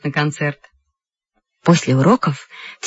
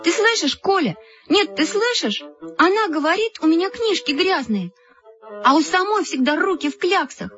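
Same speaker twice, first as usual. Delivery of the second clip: high-pitched offended complaint; outraged appeal; 10 semitones higher than usual. A narrator's reading. Offended girl complains to Kolya about her friend, outraged.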